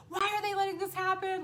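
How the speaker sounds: high, loud exasperated outburst; irritated. Retold or imitated. Mom pissed at her spiritual team, irritated. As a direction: protest in shrieky frustration. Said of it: "Like, I'm all irritated".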